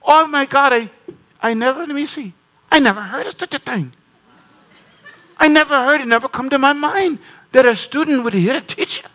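high, astonished imitation of a woman; astonished visitor. Pastor imitating his guest, high and amazed. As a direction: marvel that anyone could think it, up high. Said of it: "She said".

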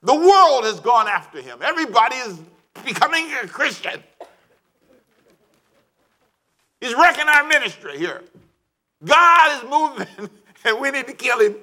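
high, loud hammed-up villain with a laugh; scheming Pharisees. Pharisees plotting to kill Jesus, hammed-up villainy. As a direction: play the jealous plotters, laughing. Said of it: "He says".

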